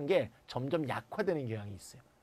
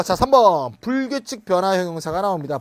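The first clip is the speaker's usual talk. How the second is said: loud, energetic lecturer's announcement; announcing new section; 3 semitones higher, 9 dB louder than usual. Real, not acted. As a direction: call out the next heading to the class. Announcing the next lesson section. (큰 소리로 다음 항목 알리기)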